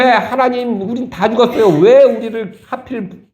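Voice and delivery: high, whining complaint to God; complaining Israelites. Retold or imitated. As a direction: wail like people sure they'll die. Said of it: "막 원망을 토했어요". (높고 징징대는 원망조)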